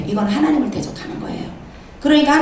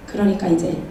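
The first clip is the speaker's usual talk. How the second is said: normal talking voice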